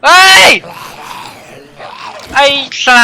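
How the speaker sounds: sudden high, loud shout; startled yell. Real, not acted. A gamer yelps as his gun reloads mid-fight. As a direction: yelp in alarm.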